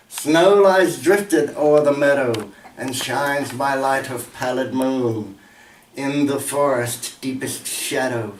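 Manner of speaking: slow, declaimed winter verse; measured, emphatic poetry reading with falling line ends; poem recitation. Acted. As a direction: recite it gravely, swelling on opening lines. Recites poem titled Charity.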